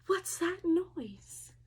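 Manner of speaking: slow, scared whisper; frightened whisper. Acted. Scared at night.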